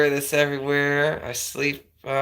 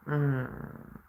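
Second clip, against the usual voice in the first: normal talking voice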